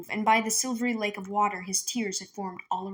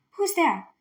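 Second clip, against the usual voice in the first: higher, louder call into the woods; shout; 8 semitones higher, 4 dB louder than usual. Acted. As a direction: call out to the unseen voice. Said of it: "Minley shouted".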